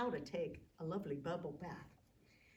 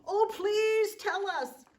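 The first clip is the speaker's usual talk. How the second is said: high, loud, slow begging; pleading shout; 13 semitones higher, 12 dB louder, slower than usual. Acted. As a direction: beg loudly but stretch it out. Giants trying to be polite.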